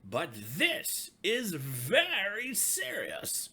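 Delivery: slow, solemn and firm; grave chief frog. A narrator's reading. Chief frog urging the animals, slow and grave. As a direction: insist on how serious it is. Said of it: "said the chief frog".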